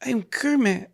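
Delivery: high-pitched introduction as the frog; Kermit. Acted. Cohost introduces the Halloween costume, high puppet voice. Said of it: "We are Miss Piggy and Kermit the Frog".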